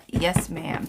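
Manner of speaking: normal talking voice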